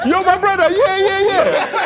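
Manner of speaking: excited calling out. Retold or imitated. Calling over an arriving stranger.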